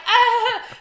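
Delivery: very high, loud scream; loud high shriek; frantic yell. Retold or imitated. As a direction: scream out in mock panic. Acting out frantic morning swim.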